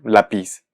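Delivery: lapiz said la-PIZ, stress on the last syllable; misplaced final stress. Shown as a demonstration. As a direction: put the weight on PIZ in "lapiz". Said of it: "que se pronuncie lápiz".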